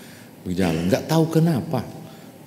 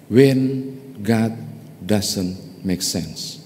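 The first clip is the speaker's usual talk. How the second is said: slow, weighty statement; much slower than usual. Real, not acted. Sermon key line, slowed.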